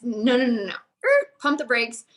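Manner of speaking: ordinary talk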